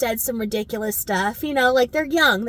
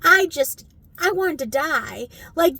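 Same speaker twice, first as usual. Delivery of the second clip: normal talking voice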